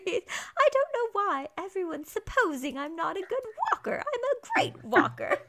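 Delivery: high, offended boasting; indignant Mary. Retold or imitated. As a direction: protest your walking skills, laughing. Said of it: "And Mary".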